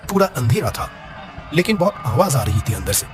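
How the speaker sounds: plain reading voice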